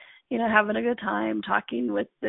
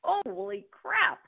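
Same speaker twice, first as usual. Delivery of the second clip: stunned; 10 semitones higher, 5 dB quieter, much slower than usual. Retold or imitated. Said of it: "I'm like".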